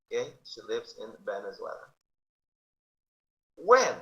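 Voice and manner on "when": teacher pronounces When for the class; model word. Shown as a demonstration. Teacher to class, loud model word. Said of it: "teacher models the word".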